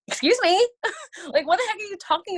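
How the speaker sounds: high, loud offended excuse me; shocked she'd say that; indignant surprise. Retold or imitated. Mom indignantly answers friend's accusation at Starbucks.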